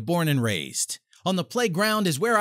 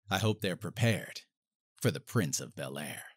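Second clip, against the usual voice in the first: dramatic hush; 10 semitones lower, 7 dB quieter than usual. Acted. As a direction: sink the voice for the big reveal. Dramatic hushed line ending.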